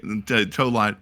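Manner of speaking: ordinary talk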